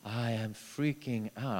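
normal talking voice

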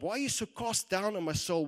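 loud, high, challenging question; forceful self-rebuke. Acted. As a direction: demand an answer from your own soul. David challenges his own soul.

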